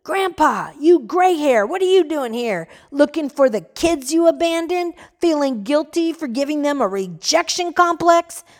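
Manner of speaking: angry shout. Acted. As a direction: hurl it at the old man. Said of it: "Another voice shouted".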